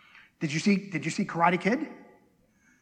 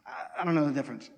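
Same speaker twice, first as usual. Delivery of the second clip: normal talking voice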